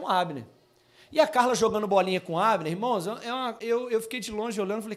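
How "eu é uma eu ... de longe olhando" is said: usual speaking voice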